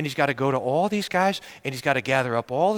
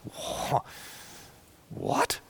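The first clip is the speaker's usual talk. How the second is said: breathless whispered what; stunned whisper; 9 dB quieter than usual. Acted. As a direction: gasp it out in disbelief. Stunned at the debt.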